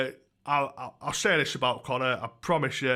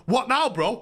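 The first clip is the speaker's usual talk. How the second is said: mocking a mouthy lad; 7 semitones higher than usual. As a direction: mimic a gobby lad squaring up. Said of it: "I swear to God".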